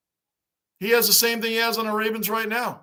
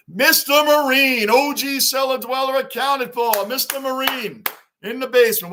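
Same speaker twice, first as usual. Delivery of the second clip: excited shout-out; 6 semitones higher, 5 dB louder than usual. Real, not acted. Streamer hyping up a viewer in chat, loud and excited. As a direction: call his name out big to the chat.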